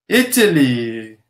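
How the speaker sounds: normal talking voice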